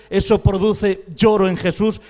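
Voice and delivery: ordinary delivery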